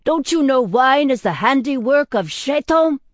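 loud, high accusing question; outraged roar. A narrator's reading. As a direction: thunder it at the drinker in outrage. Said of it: "the man roared".

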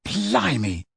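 lightly voiced awed exclamation; a quick, impressed blurt; audible gasp of wonder. A narrator's reading. Ron awed as the ceiling fills with stars, a light exclamation. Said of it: "Ron said audibly".

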